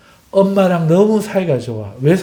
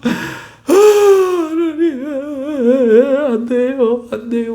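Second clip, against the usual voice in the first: tearful pleading; 8 semitones higher, slower than usual. Acted. Believer crying out helplessly to God, tearful. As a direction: wail a breathy cry, then plead helplessly. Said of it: "울면".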